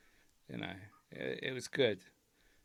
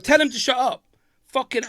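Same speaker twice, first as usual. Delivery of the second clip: high, louder indignant rant; exasperated rant; 11 semitones higher, 9 dB louder than usual. Real, not acted. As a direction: vent it loud and high in disbelief. Ranting at co-host, pitch way up.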